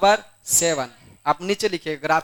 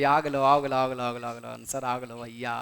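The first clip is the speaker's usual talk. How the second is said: low, hushed imitation of an over-excited student; mock excited cheering; 6 semitones lower, 6 dB quieter than usual. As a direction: chant it under your breath like a pretend celebration. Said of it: "answer आ गया, और चिल्लाने मत लगना". (दबी आवाज़ में जश्न की नकल)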